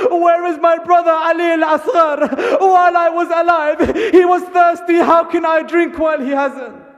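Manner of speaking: high, loud grieving cry, slowing in the middle; anguished calling out. Acted. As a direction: call out for the lost brother. Sakina calls for her brother.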